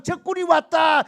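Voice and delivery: loud, high alarm cry; panicked shout. Retold or imitated. Preacher voicing Midianites screaming in the night, panicked shout.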